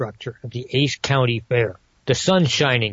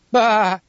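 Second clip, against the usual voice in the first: sheep bleat; 6 semitones higher than usual. Acted. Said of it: "that just came out".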